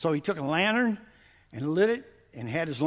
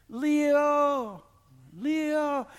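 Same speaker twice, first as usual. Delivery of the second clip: high, sustained calls; nearing distant call; 9 semitones higher than usual. Retold or imitated. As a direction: imitate a searcher's call growing clearer. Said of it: "the louder it get".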